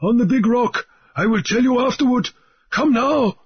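high, hurried urging; high, pressing urgency; urgent islander. A narrator's reading. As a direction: hurry the captain along. Mauriri urges flight.

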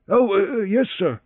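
startled, high, hurried servant; hasty valet. A narrator's reading. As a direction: blurt it out caught off guard. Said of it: "he said hastily".

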